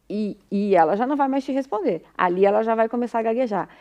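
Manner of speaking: usual speaking voice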